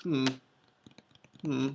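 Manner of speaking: plain reading voice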